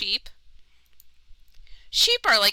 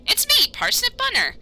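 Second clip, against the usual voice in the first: much higher, chirpy bunny character; high rabbit voice; 3 dB louder than usual. Acted. Player voicing Parsnip to a stranger, high cheerful rabbit. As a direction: play a cheerful little rabbit.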